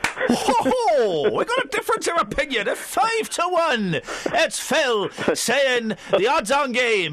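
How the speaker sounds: put-on Scouse game-show patter; high, drawn-out Liverpool host voice; Jimmy Tarbuck. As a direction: hoot it out in broad Scouse. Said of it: "I was doing a Scouse accent".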